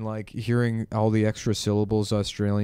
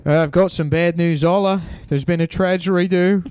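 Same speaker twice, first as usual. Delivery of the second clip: mock Aussie; 5 semitones higher, 4 dB louder than usual. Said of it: "have to be like".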